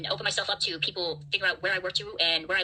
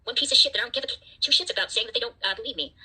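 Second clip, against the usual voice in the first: usual speaking voice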